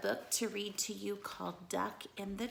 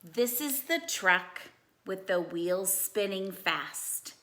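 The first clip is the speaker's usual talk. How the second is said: lively storybook read-aloud; animated bedtime-story reading; reading to children; 4 semitones higher, 8 dB louder, slower than usual. Acted. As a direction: read it like a picture book for kids.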